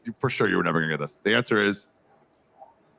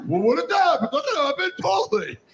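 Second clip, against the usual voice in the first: mock alarm. Mock-worried exclamation.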